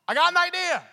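a hint of a cheerful grandpa. Grandpa to grandson stuck in pond, lightly voiced.

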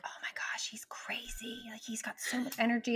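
hushed, whispered disbelief; aghast whisper. Retold or imitated. Recalling a friend's wild three-year-old, aghast whisper. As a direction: whisper it like a private thought. Retold private shock at wild child.

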